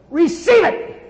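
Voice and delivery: high, loud commanding yell. Retold or imitated. Preacher yelling a command to the woman, loud yell. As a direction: bark the command with full force. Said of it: "It's the first time I'd ever yelled like that".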